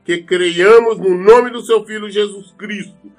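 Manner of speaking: usual speaking voice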